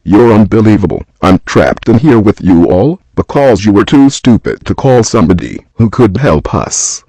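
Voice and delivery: loud, bitter yelling; angry shout. Acted. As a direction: shout out his frustration. Furious at being trapped.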